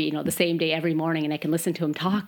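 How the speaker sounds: ordinary delivery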